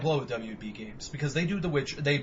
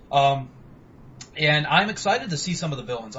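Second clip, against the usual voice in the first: normal talking voice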